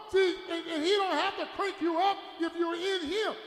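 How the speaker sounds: emphatic sermon shout; full-force preaching. Real, not acted.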